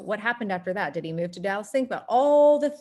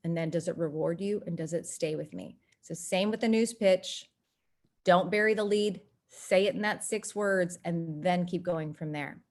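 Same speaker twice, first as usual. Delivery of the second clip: ordinary talk, nothing marked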